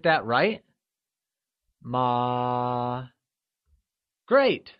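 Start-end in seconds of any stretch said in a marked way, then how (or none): 1.8-3.1 "ma" held long and level; high level tone. Shown as a demonstration. Teacher gives the correct answer, "ma" in high level tone. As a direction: sustain "ma" on a single pitch. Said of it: "constant high-level tone".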